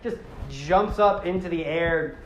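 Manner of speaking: normal talking voice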